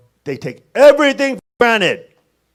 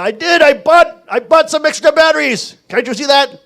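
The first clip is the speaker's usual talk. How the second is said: raised, loud defensive protest; exasperated husband impression; 8 semitones higher, 6 dB louder than usual. Husband answering his nagging wife, loud mock exasperation. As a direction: plead your case as a mock put-upon husband.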